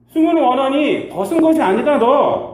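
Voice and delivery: loud, high rebuke; God exposing why Adam hid; God rebuking. Acted. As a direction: nail him with the accusation. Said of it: "이렇게 말하는 겁니다".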